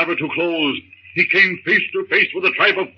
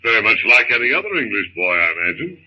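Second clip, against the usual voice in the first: calm his frightened wife in a deep voice; husband's voice; 10 semitones lower than usual. Acted. John answers wife.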